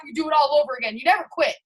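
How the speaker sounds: ordinary delivery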